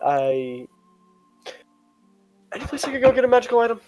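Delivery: ordinary talk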